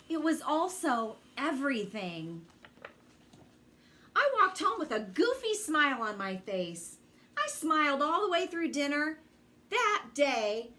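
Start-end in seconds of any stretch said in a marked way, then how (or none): none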